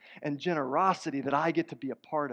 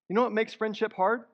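ordinary talk

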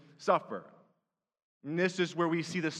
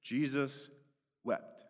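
Jesus wept, softer and lower with a long pause between; hushed scripture quote; 6 semitones lower, 5 dB quieter, much slower than usual. Shown as a demonstration. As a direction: let Jesus wept fall into a hush. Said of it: "And the scripture says".

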